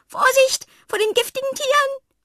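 very high, loud shriek of warning; panicked scream. Acted. Fred screams a warning from under the table, panicked. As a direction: yell in terror from under the table. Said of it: "und schreit".

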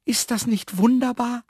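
very high delighted exclamation; joyful anticipation. A narrator's reading. Man sees his tomato plants, high joyful cry. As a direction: exclaim it full of happy anticipation. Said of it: "rief er voller Vorfreude".

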